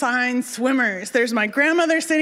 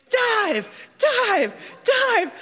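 mock crowd shout; 11 semitones higher, much slower than usual. Crowd on the shore urging her to jump, mock shouting. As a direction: imitate a crowd egging someone on. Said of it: "they're all shouting".

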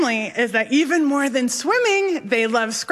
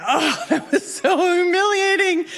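mock humiliation; 7 semitones higher than usual. Acting out one imagined self-pitying reaction.

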